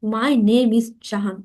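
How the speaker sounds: slow, careful classroom model; teacher modelling an English sentence slowly. Shown as a demonstration. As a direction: spell it out slowly for learners. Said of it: "নাম ইংরেজিতে ঠিকভাবে বলার জন্য".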